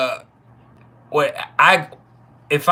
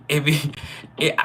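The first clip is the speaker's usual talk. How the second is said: ordinary talk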